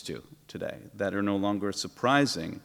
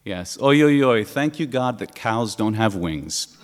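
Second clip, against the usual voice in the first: loud praying oi, ending in a laugh; punchline prayer, loud oi then a laugh; devout prayer; 6 dB louder than usual. Retold or imitated. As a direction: chant thanks while rocking in prayer. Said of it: "bowing back and forth and meditating".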